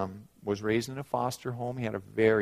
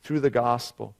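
loud, raised preacher's delivery; emphatic preaching; 4 semitones higher, 9 dB louder than usual. Acted. Preacher telling the congregation of eternal life, emphatic preaching. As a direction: drive the words out to the congregation.

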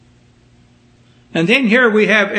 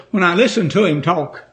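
usual speaking voice

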